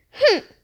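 a short high grumpy snort of a humph. A narrator's reading. Pig Marvin huffing at Nick over breakfast, grumpy humph. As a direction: huff it out like a grumpy old man. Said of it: "Marvin grumped, like a grumpy old man".

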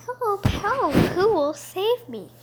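high, loud damsel crying for help; cartoon princess. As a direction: cry out like a helpless storybook princess. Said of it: "cried the pretty princess".

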